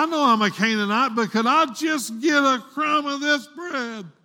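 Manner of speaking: high, loud imitated begging, building to a shout; impassioned pleading. Woman begs the Lord for a crumb, impassioned pleading. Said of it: "praying and pleading with the Lord".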